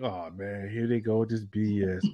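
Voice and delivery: low exasperated grumble; muttered aside. Retold or imitated. Retelling his reaction to the caller, a soft disgusted mutter.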